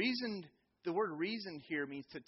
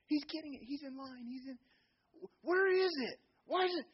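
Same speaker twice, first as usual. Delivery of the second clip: flustered mom; 11 semitones higher, 4 dB louder than usual. Retold or imitated. Flustered mom answering from stands.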